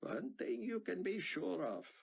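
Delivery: a hint of a thick Israeli accent. A narrator's reading. Sion reassuring Buck, Israeli accent. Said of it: "in his thick Israeli accent".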